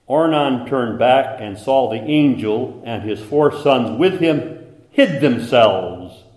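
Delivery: loud, slow, weighty scripture reading; preaching scripture aloud. Acted. Preacher reading the verse to his congregation, loud and slow. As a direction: proclaim each word slowly to the room. Reads scripture at full force.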